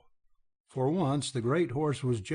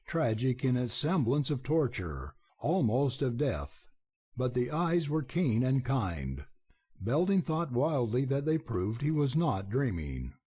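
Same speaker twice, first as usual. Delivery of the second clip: ordinary delivery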